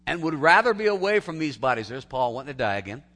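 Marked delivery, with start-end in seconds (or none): 0.1-1.9 emphatic proclamation. Acted. Paul quoted, preacher swells.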